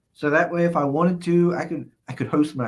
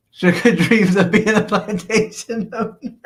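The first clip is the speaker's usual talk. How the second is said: higher, louder, words shaking with laughter; laughing while reading; 6 semitones higher, 4 dB louder than usual. Real, not acted. Streamer reading a viewer's tease about himself, laughing hard. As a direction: read it through helpless laughter. Cracks up reading chat aloud.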